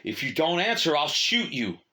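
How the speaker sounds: loud, high, furious warning; angry threat. Acted. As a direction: shout the threat at him. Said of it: "The soldier's anger kindled".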